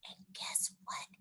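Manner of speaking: soft secret whisper in his ear. Acted. Junie B whispering a secret in Grandpa's ear. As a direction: breathe it like a secret. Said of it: "I whispered".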